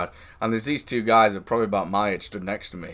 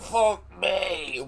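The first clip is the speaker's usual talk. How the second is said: mock exasperated; 9 semitones higher than usual. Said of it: "I'm like".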